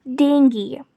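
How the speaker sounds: "dengue" as DENG-gee; hard g and ee ending on "dengue"; correct pronunciation. Shown as a demonstration. Teacher repeats the correct way, "dengue" as DENG-gee. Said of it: "The correct way is".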